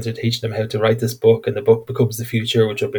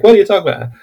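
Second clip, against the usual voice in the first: incredulous; 9 semitones higher, 6 dB louder, much faster than usual. Retold or imitated. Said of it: "And he's like".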